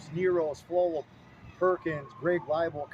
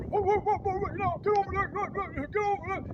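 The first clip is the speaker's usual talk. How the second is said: much higher mock coach yelling; Coach Hamey yelling; faster than usual. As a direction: wave him off like a frantic coach. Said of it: "And Coach Hamey".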